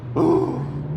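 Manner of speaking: a sharp theatrical gasp of shock; mock gasp. Gasping at the wallet excuse, mock shocked. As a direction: gasp as if scandalised.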